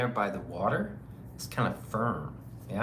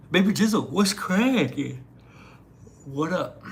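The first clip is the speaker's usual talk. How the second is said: loud, stretched, excited shout-out; hyped greeting; 7 semitones higher, 6 dB louder than usual. Real, not acted.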